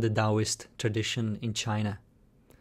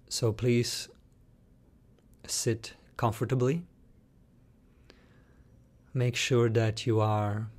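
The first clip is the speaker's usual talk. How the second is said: guided meditation. Shown as a demonstration. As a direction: lead them calmly, letting each phrase settle into silence.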